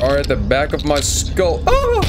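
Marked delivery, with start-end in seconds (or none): 1.7-2.0 very high, loud shriek of fright; startled scream. Real, not acted. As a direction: yelp out loud at a sudden scare. Startled during horror game.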